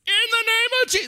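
very high, loud Southern revival-preacher bellow; hammed-up Southern holler, cut short; Southern exorcist shout. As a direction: burst out with a Southern exorcist's cry. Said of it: "it's a Southern accent".

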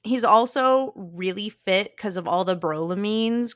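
usual speaking voice

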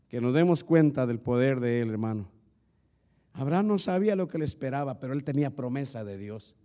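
normal talking voice

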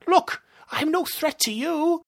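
high-pitched plea; frightened pleading. Acted. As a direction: beg the creature for mercy. Villain pleads with the monster.

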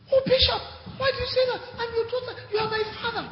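very high, squeaky mimicked plea; high-pitched pleading church daughter impression; imitated protesting woman. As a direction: mimic her protest in falsetto. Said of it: "So she said".

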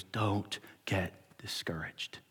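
slow, soft, breathy plea; an older man with tears urging him on; tearful whisper. Retold or imitated. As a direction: whisper it with quiet urgency.

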